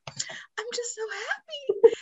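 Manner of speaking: put-on the little girl sobbing happily, in a breathy whisper; imitating tearful daughter. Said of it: "She was like".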